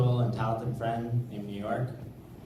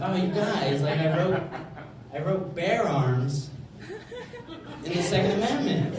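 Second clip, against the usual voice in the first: laughing, higher, proud of a pun; giggling Adams; 7 semitones higher than usual. Retold or imitated. Comic imitating John Adams, laughing at his own pun. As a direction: chuckle through the bragging. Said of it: "he's like".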